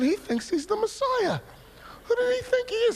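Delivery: high, mocking disbelief; incredulous thought. Jealous twin scoffing at his preaching brother, incredulous. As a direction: scoff at your brother's nerve. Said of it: "I'm just sitting there thinking".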